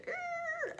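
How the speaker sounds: high squeaky scraping noise, half laughing; mock scrape noise. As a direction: make the grinding scrape sound with a laugh. Imitating the scrape of the car.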